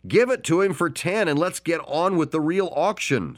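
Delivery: high, impatient call from the crowd; impatient pipe-up. Acted. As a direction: hurry the auctioneer along. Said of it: "someone piped in".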